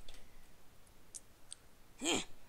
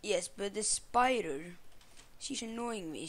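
usual speaking voice

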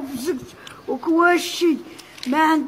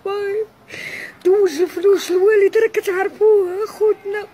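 crying; 4 semitones higher, 4 dB louder than usual. Real, not acted. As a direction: voice breaking, higher through tears. Crying about her late father.

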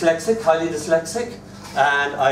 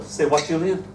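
ordinary talk

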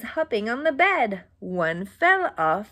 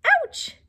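ouch; 3 dB louder than usual. Acted. Bunny bumps head.

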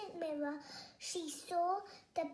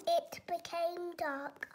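ordinary delivery, nothing marked

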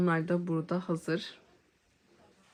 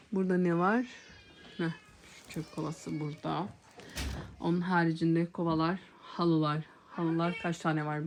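ordinary delivery

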